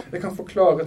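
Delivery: acted urgent begging. A narrator's reading. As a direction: plead for a chance to explain. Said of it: "tryglet hun".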